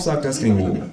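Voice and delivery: plain reading voice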